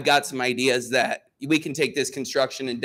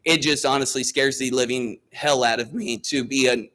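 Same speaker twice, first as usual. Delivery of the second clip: normal talking voice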